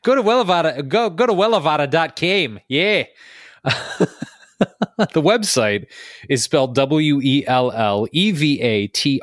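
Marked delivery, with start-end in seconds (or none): 0.0-3.0 laughing through a South African accent; hammy South African accent, giggling. Shown as a demonstration. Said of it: "horrible South African impression".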